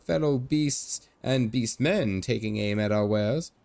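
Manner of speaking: put-on jolly old Irish merchant showing off; Irish leprechaun merchant. Old merchant boasting to strangers in a hammed-up Irish brogue.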